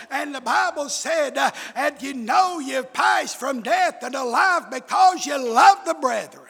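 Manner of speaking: rhythmic revival sermon cadence; full-force preaching. Real, not acted. Preacher recalling doubt and quoting the Bible, full-force preaching. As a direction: drive each phrase to the congregation.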